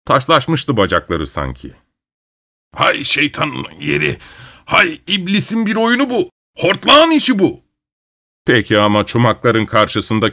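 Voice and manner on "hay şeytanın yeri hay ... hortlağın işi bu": grandfather's mock curse. Grandfather cursing his stuck legs.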